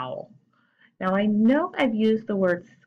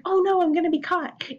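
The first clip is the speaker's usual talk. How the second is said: mock scared mouse; 9 semitones higher than usual. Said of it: "It's like".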